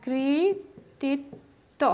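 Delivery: কৃতিত্ব stretched very slowly, each syllable held; drawn-out example. Shown as a demonstration. Teacher holds out কৃতিত্ব for students, very slowly. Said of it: "যেমন".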